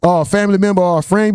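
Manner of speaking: ordinary talk, nothing marked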